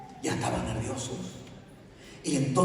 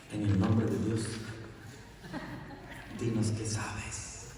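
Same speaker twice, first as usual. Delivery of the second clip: hushed pleading; 5 semitones lower, 5 dB quieter, slower than usual. Acted. Parents pleading with child.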